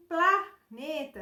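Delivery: slow "planeta" with a high, loud, separated PLA; stress on PLA. Shown as a demonstration. Teacher pointing out P, L and A, syllable stressed. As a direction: punch PLA, then finish "planeta". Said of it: "em voz alta para perceber os sons".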